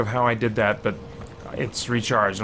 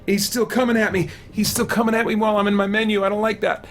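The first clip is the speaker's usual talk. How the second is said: high, frantic reading under pressure; hurry, it's coming at you; frantic panic ending in a high shout; panicked rush; 9 semitones higher than usual. Real, not acted. Panicking in menu while attacked.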